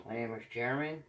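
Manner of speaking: talking around a pin in the mouth; words muffled by something held in the mouth; mouth full. Real, not acted. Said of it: "I have my D P pin in my mouth".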